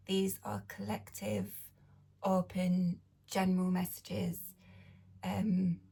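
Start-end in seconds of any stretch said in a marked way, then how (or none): none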